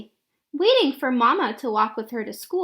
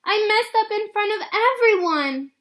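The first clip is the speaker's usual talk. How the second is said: put-on upset little girl; 8 semitones higher, 4 dB louder than usual. Girl ashamed after the play.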